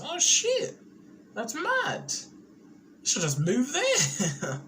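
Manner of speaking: high, loud shocked outburst. Real, not acted. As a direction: blurt it out in disbelief. Reacts to one virus case.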